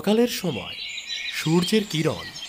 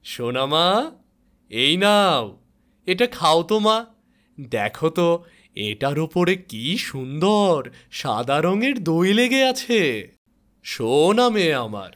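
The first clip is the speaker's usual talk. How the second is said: tender father; 3 semitones higher, 5 dB louder than usual. Acted. Father feeding daughter lovingly.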